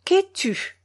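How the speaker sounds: high, pompous solemn question; solemn old craftsman. A narrator's reading. Gicarev solemnly addressing the orphan boy, high and pompous. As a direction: puff up and ask it grandly. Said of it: "prononça d'un ton solennel".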